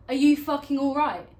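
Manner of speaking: loud, high challenge building to a yell; fuming confrontation. Acted. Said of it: "Naturally, I'm fuming".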